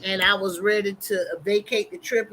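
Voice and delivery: ordinary delivery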